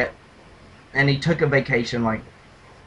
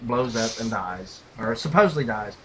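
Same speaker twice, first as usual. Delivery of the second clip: normal talking voice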